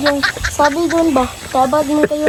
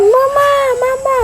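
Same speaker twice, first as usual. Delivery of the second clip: put-on high child's call of mama; 12 semitones higher than usual. Child quoting a sibling calling for mama. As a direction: call mama like a little kid. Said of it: "Sabi niya".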